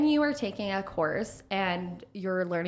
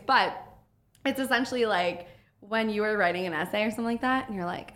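ordinary talk

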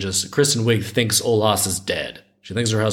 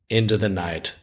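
usual speaking voice

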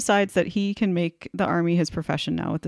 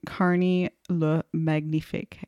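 halting try at a French accent; hesitant, badly attempted French pronunciation; slower than usual. Shown as a demonstration. Host stumbling over a French nickname, attempted French accent. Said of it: "I can't do a French accent".

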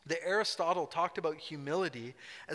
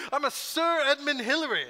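high, loud, hammed-up posh British voice; pompous British; 9 semitones higher, 6 dB louder than usual. A pretend-offended Hillary putting on British airs, high and loud. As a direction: puff up like an offended English lord. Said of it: "he could have gotten all British".

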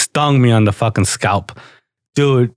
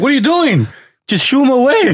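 high-pitched exasperated scolding; mock mad dad; 11 semitones higher than usual. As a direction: imitate an annoyed dad, pitch shooting up. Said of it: "he got mad at me".

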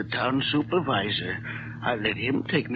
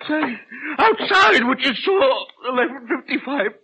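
urgent pleading; 11 semitones higher, 7 dB louder than usual. Acted. Father urging stepson to report the wreck. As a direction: press him hard to make the call.

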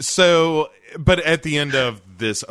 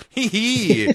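high mock-devil giggle; a prancing devil tittering up high; giggly devil; 13 semitones higher, 4 dB louder than usual. Prancing devil's giggle as he reveals himself.

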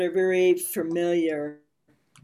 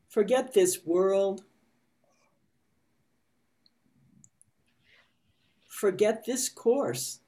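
guided meditation reading. A narrator's reading. Reads meditation passage slowly.

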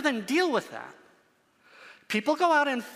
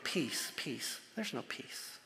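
the words drop to a near whisper, much softer; a low, breathy hush falling away to nothing; hushed quote; 7 semitones lower, 13 dB quieter, slower than usual. Retold or imitated. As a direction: let "peace, peace" fade down to a breath, then whisper "There's no peace". Quoting the false prophets' empty reassurance.

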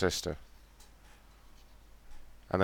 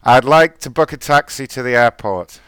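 over-enunciated order; 3 semitones higher, 6 dB louder than usual. Pretend. Prank caller hamming to driver.

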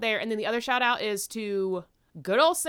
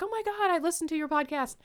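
excited fan; 9 semitones higher than usual. Retold or imitated. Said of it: "And she's like".

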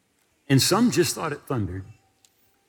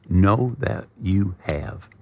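every word slowed and weighted, "Know that you have"; slow emphatic quote; 4 semitones lower, much slower than usual. Acted. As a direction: lean on "Know", drawn out and deliberate. Quoting scripture with emphasis.

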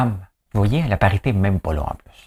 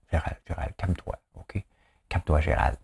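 chuchoté moqueur; 4 semitones lower, 9 dB quieter than usual. Pretend. S'adresse moqueusement à Gérald.

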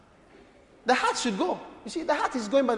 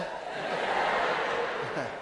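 soft breathy chuckle; quiet breathy laugh; 11 semitones lower, 10 dB quieter than usual. Real, not acted. Pastor laughs at his own Ewe joke, hushed. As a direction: let a hushed laugh slip out.

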